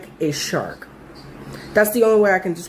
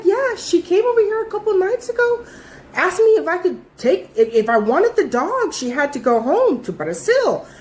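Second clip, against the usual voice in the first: neighbor voice; 9 semitones higher than usual. Retold or imitated. Neighbor explains the dog.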